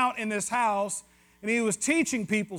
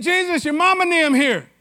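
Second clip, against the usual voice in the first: loud Southern call into the house, raised high; yelled back; 6 semitones higher, 7 dB louder than usual. Retold or imitated. Disciple hollers to Jesus in the house, loud Southern call. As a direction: holler it back across the room. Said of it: "he yells back at Jesus and said".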